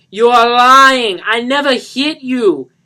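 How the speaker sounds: very loud, high, slow enraged yell; furious accusation. Acted. Johnny jabbing Lisa's shoulder, slow furious yell. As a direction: hurl the accusation. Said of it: "he said".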